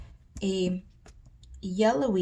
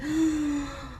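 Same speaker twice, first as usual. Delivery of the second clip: surprised gasp; 6 semitones higher than usual. Real, not acted. Discovers forgotten pastel markers.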